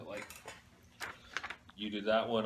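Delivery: ordinary talk, nothing marked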